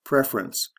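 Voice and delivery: "Preference" stressed on PREF; teacher's model of "Preference", beat on PREF; stress on first syllable. Shown as a demonstration. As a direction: lean on the opening PREF of "Preference". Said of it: "first to third syllable".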